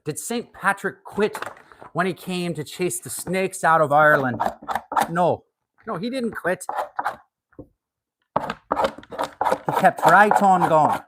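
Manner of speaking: high, slow mock Irish storyteller; Irish accent. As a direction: spin the Saint Patrick tale in a hammed-up Irish brogue. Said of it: "in an Irish accent today".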